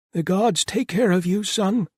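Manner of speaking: put-on very high, loud older woman's voice; high-pitched, forceful blessing. A narrator's reading.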